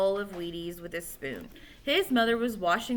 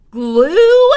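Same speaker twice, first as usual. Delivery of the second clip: a sudden shriek, much louder and higher; sudden scream; 8 semitones higher, 18 dB louder than usual. A narrator's reading. Billy screams at his friends over the trick. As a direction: blurt it out as a shocked scream. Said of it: "screamed Billy all of a sudden".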